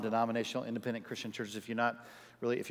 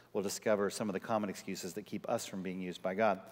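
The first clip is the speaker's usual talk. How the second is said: normal talking voice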